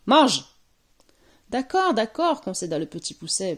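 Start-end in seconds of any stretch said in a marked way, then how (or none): 0.1-0.4 loud, raised shout; one-word witch bellow; thundering order. A narrator's reading. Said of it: "Puis elle tonitruant". 1.5-2.4 higher, mildly nervous agreement; a touch of worry; uneasy little boy. A narrator's reading. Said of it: "pas très rassuré".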